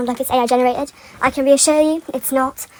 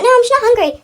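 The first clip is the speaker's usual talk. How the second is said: higher, sing-song mock innocence; innocent fib; 11 semitones higher, 4 dB louder, faster than usual. Fan mocking a villain, higher sweet fake innocence. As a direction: play it falsely innocent. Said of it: "Or be like".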